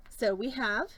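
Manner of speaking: ordinary talk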